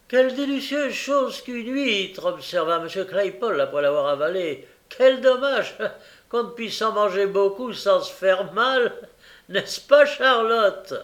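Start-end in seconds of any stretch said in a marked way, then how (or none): 0.1-2.2 high-pitched tipsy gourmand; tipsy, delighted Claypole. Acted. Claypole savouring oysters with Charlotte, tipsy delight. 4.9-10.9 high, loud tipsy lament; tipsy, regretful Claypole. Acted. Claypole asking Charlotte to agree about oysters, tipsy regret.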